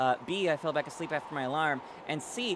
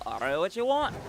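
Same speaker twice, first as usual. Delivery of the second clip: a light Australian accent, a bit louder; Aussie accent practice; 2 semitones higher, 4 dB louder, faster than usual. Shown as a demonstration. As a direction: try out a casual Australian voice. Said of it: "I gotta practice my Aussie accent".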